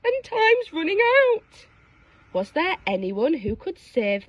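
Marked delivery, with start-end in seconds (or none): 0.0-1.7 high desperate plea; pleading. Acted. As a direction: plead as it melts. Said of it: "pleaded Lolly".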